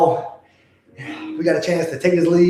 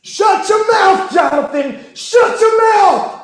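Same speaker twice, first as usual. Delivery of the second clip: high, loud, slow taunting shout; yelling at the commentator; 12 semitones higher, 3 dB louder, slower than usual. Real, not acted. Fan yells at TV commentator Jonathan, slow loud taunt. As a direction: drag out each word to shut him up.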